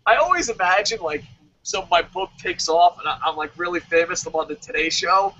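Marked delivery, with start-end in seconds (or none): none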